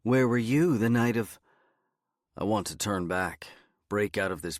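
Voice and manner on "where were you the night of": lightly voiced interrogator, loud and higher; firm mock interrogation, raised voice. A narrator's reading. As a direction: grill them like a mock detective. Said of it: "her best interrogator impression".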